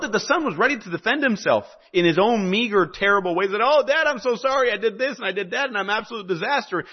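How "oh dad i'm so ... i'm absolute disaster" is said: son's plea voiced higher, half-acted; a hint of a tearful son begging his dad; pleading son. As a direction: lightly play the sorry son begging. Son begging his father's forgiveness.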